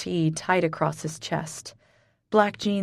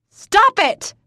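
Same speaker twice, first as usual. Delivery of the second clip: high, very loud screamed plea; shrieking stop it; 9 dB louder than usual. Acted. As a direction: scream it at him, frantic. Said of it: "I scream".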